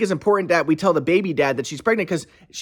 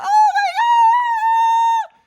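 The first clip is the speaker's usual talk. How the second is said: very slow, stretched, very high falsetto; mocking his ex; 4 dB louder, much slower than usual. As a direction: stretch it out way up high as a dramatic mock gasp. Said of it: "she's like".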